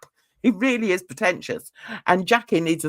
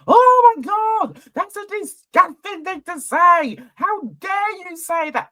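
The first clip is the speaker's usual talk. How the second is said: mock outrage; 12 semitones higher than usual. Husband reacting to the Brit's crude remark, hammed-up outrage. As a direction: overact being deeply offended, voice pitched way up.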